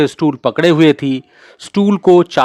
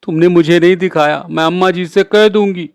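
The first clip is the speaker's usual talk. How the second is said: tearful threat; 5 semitones higher than usual. A narrator's reading. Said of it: "आंखों में आंसू भरकर कहा".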